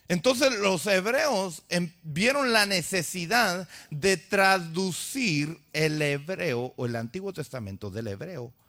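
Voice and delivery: ordinary talk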